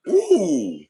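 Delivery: high loud ooh of delight; a sudden high whoop at own point; squealing ooh; excited ooh. Real, not acted.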